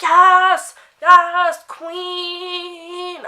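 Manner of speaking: loud, high fan scream; hollering. Retold or imitated. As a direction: scream it from the crowd like a superfan. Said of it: "I'm hollering".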